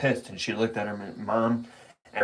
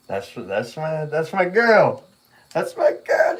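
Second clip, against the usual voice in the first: proud cheer; 4 semitones higher than usual. Retold or imitated. Proud dad reacting to daughter.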